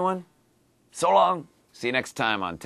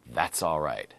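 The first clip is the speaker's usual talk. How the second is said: soft reassurance; 6 semitones lower, 3 dB quieter than usual. Shown as a demonstration. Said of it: "today's dialogue".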